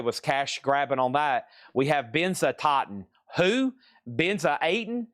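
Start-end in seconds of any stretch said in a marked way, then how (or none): 3.4-5.1 puzzled who. Real, not acted. Puzzled by an unknown band name.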